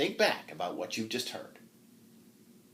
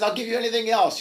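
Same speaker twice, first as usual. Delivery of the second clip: high, loud desperate plea; pleading; 12 semitones higher, 9 dB louder, faster than usual. Acted. Knight begging the old woman to ask anything else, loud and high. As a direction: beg her to take anything else.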